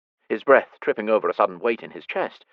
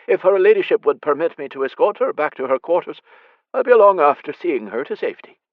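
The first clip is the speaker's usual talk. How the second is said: louder, formal genteel offer; higher, louder courtly speech; courtly stablemaster; 7 semitones higher, 7 dB louder than usual. Acted. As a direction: put on his finest manners. Gentility of phrase.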